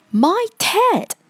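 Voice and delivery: high, startled exclamation; astonished gasp. A narrator's reading. The bear spots its own Ted, astonished gasp. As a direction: exclaim it with a sudden jump in pitch. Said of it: "gasped the bear".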